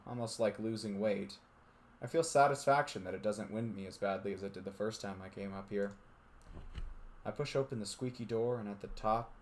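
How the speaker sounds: steady narrator reading a visual novel; story narration. A narrator's reading. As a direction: read it like a storyteller.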